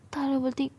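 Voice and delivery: usual speaking voice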